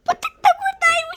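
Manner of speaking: very high, loud teary squeal; squealing delight. Acted. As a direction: squeal the puppy's name in tearful delight. Grandma spots the puppy again.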